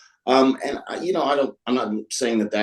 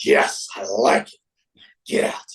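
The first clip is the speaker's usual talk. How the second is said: big boss voice. Retold or imitated. Big boss approves the haircut.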